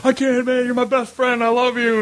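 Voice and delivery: very high, strained declaration; hammed-up emotional outburst; overwrought mock plea. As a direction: plead melodramatically with your best friend. Acting out overwrought farewell between friends.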